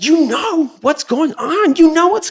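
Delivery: high, slow, seething repeat; slow high accusation. Retold or imitated. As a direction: drag every word out at him. The confrontation at the door.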